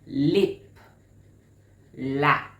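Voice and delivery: LIP and LAP loud, one at a time; teacher's listening drill, LIP then LAP; overemphasized vowel sounds. Shown as a demonstration. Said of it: "Listen again".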